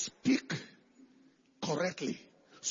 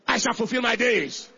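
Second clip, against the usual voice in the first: loud declaration at usual pitch; lifted voice; 7 dB louder than usual. Shown as a demonstration. Preacher leading the congregation's confession, lifted voice. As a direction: lift your voice and declare it. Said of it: "Lift up your voice".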